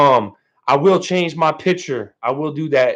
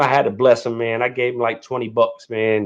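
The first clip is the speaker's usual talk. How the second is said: ordinary talk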